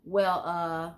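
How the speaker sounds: stalling with isolated filler words; filler-laden hesitation. Shown as a demonstration. Speaker listing empty words as examples, hesitant and stalling. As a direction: trail each filler off as if searching for words. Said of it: "I can't think of 'em".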